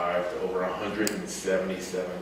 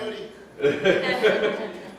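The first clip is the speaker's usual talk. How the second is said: laugh; 13 semitones higher than usual. Real, not acted. Laughs before story.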